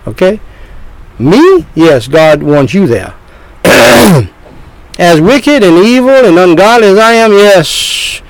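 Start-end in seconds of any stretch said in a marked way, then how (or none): 1.2-1.7 sharp high disbelieving question; incredulous me. Pretend. Imagined listener asks in disbelief. 5.0-8.2 doubting sinner voice. Pretend.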